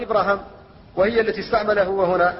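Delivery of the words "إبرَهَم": "إبراهام" as Ibraham, both a vowels short; both alifs dropped. Shown as a demonstration. Teacher listing variants, "إبراهام" with both long vowels shortened. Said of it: "أو يحذف الألفان معا فيقال".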